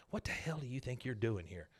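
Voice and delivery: hushed, angry half-whisper. Retold or imitated. Nancy hisses at John Redcorn to keep it secret, hushed whisper. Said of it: "she starts whispering to him".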